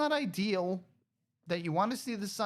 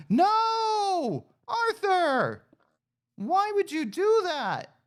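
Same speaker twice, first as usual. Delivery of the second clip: high loud cry of dismay; dismayed shout; 13 semitones higher, 6 dB louder, much slower than usual. Real, not acted. Player shouting as pig Arthur jumps out, high dismayed cry.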